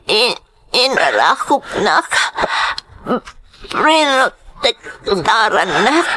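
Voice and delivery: slow, crying after the crash; tearful gasp. Acted. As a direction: sob through the shock. Injured woman after crash.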